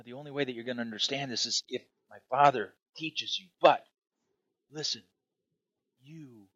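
ordinary delivery

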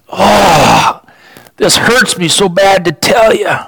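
lamenting. Retold or imitated. Prophet grieving to the people, a touch of a lament. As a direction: grieve over the bad news you must give. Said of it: "he's weeping for them".

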